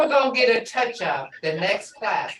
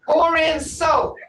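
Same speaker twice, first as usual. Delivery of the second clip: loud, slow instruction; 6 semitones higher, 5 dB louder, slower than usual. Shown as a demonstration. Teacher calls out an instruction.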